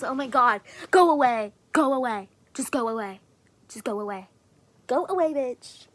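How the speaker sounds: loud, fed-up shouts at her own odd voice; shouting at own voice. Real, not acted. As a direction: yell go away at the strange voice. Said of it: "What does my voice sound like this?".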